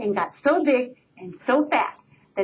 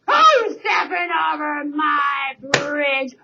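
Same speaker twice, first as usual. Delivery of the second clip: troll roar; 2 semitones higher, much slower than usual. Acted. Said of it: "roared the troll".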